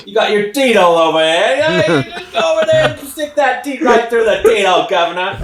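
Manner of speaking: mock British farmer explaining in a Cockney accent. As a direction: put on a jokey London Cockney accent. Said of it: "Your British accent".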